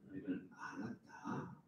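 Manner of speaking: slow, hushed whisper; slow whispered reassurance. Acted. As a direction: breathe it softly like a secret comfort. Tender repeat of the verse.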